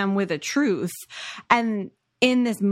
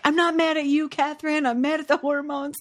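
mock yell; 6 semitones higher than usual. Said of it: "when he's yelling".

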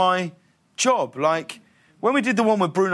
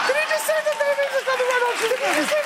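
hammed-up falsetto shriek of disbelief; imitated astonished woman. Woman realizing who saved the baby, squealing incredulously. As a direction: go way up high, mock incredulous squeal.

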